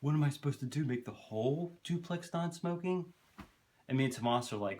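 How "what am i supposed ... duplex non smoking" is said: imitating the neighbor, high and indignant; mock exasperated question, climbing and slowing at the end; higher-pitched voice. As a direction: put on a high voice and protest. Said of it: "this kinda higher pitched voice".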